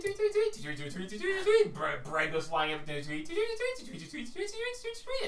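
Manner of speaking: very high, sing-song bird chirps; playful tweeting birdsong; high chirping tweets. Acted.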